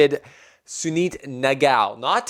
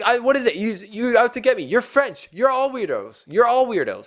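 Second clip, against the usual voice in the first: high-pitched, hammed-up indignant accusation; mock Tsitsipas rant; 10 semitones higher than usual. Tennis player accusing the French umpire, high mock outrage. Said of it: "he's like".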